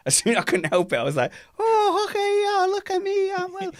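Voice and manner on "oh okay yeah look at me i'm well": very high falsetto caricature; Michael Weddle impression. Repeating the race commentary gag on friend, squeaky impression. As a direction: squeak it out as a silly send-up. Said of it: "that exact same impression of him".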